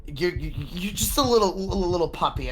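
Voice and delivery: nervous guard stumbling over repeated words; fearful stammer. Acted. Hesitant guard facing the intimidating intruder, fearful. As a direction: stammer as if losing your nerve. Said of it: "you hear the fear in their eyes".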